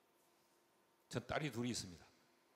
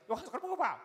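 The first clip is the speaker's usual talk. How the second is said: high, loud daughter voice; pushy younger daughter; 10 semitones higher, 8 dB louder than usual. Retold or imitated. Second daughter asking dad about his meal, boisterous. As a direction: imitate a daughter pestering dad at full pitch. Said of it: "막 이래요".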